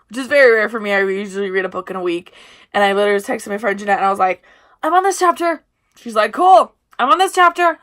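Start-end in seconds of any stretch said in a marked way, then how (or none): 4.8-5.6 high, loud chirp; excited mock texting voice; eager retelling. 6.3-7.8 high, loud imitation of a friend; pitched-up mock texting voice; eager retelling. Retells friend's excited reply.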